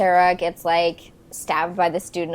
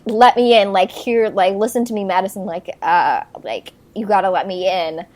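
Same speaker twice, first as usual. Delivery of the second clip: raised voice, urgent retold plea; loud, lightly acted urgency; mock pleading demand; 8 dB louder than usual. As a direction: act out banging to be let in. Voices neighbor pounding on door.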